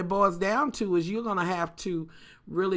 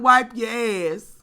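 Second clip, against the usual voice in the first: mild mock holler; 3 semitones higher, 5 dB louder, slower than usual. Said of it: "She's hollering".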